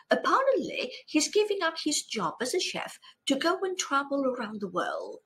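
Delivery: ordinary delivery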